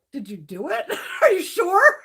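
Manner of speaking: laughing disbelief. Retold or imitated.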